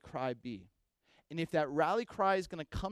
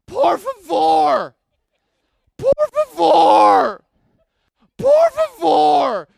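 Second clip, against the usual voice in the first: drawn-out frantic yells, much higher and louder; desperate screaming; 10 semitones higher, 22 dB louder, much slower than usual. Retold or imitated. Drowning friend screaming for help in desperate yells. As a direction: scream it like a drowning man begging. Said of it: "he's screaming".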